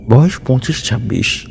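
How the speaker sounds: plain reading voice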